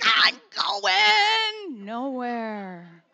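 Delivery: the hamster on the wheel, wailing slowly. Pretend. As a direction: wail it like the hopeless hamster on the wheel. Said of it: "He's like".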